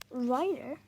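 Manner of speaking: loud, rising angry snap; snapping. Acted. Dad snaps at the name of Tom's leader. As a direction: bark the name back twice. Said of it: "Dad snaps".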